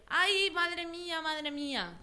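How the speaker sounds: high, drawn-out wail of alarm; crying out. A narrator's reading. Fox cries out after a mouthful from the jar, crying out. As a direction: cry out in storybook distress, starting high and easing off. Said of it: "de pronto empieza a gritar".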